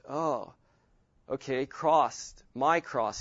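normal talking voice

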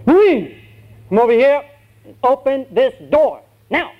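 loud, high-pitched yelled command; drill sergeant. Acted. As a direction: holler orders like a drill sergeant. Sergeant yells an order.

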